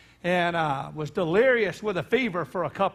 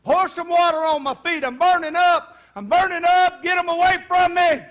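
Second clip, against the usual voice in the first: rising panicked cries, much higher and louder; retold terrified screaming; 7 semitones higher, 6 dB louder than usual. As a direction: scream as if burning and haunted. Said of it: "he began to scream".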